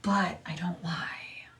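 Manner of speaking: pointed whisper under the breath; whispered aside. Real, not acted. Streamer whispering a jab about her rival, hushed.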